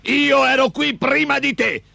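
high, slow, indignant ogre voice; angry ogre. A narrator's reading. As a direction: protest in a high-pitched creature rage. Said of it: "dalla rabbia cominciò a saltare". (orco arrabbiato, voce acuta)

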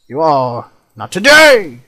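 high, loud shout of refusal; defiant shout. Real, not acted. Gamer shouting at attacking mobs, loud defiance. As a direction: yell it at the mobs, not today.

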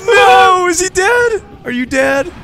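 high, loud disbelieving yell; raging gamer. Real, not acted. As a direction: shout in shock at a teammate's death. Teammate just died.

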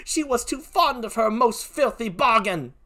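put-on British accent building to outrage; Emilia. Gentlewoman curses her husband, increasingly high, British-accented. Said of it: "please forgive my poor British accent".

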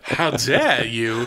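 slow, indignant Southern belle; sweet southern shade. Pretend. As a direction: gasp it like an offended belle. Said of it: "my Southern Belle impression".

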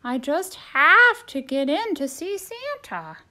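high, loud and pleading; desperate turkey voice. Acted. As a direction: insist on seeing Santa.

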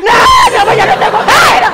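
mother loses patience with her daughter; exasperated outburst. Acted. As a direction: blurt it out in exasperation.

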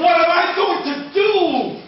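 loud, high wail of helplessness; despairing cry. Acted. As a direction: cry it out to the sky. Chief despairs after losing deer.

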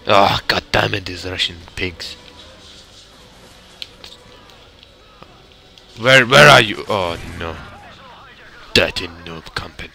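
amateur mock Russian accent, grumbling. Gamer trying a Russian impression for the Let's Play, Russian accent. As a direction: curse them in a put-on Russian accent. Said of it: "I should do a Russian impression".

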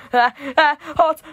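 loud high yelp of pain; scream. A narrator's reading. Narrator spills hot soup, loud high yelp. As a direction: shriek from the scalding soup. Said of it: "I screamed".

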